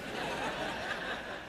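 a soft breathy chuckle; quiet laugh. Real, not acted. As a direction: laugh under the breath at the joke. Laughs at the cabbie quote.

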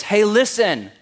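loud, high mock preacher voice; mocking pundit. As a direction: ham up a self-righteous TV pundit. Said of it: "comes on national television ... and says".

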